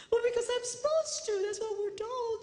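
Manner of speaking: high, lightly voiced meek woman; meek lady. Crying lady at conference explains herself, high meek voice. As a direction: answer like a timid wife doing her duty. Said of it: "why do you do that".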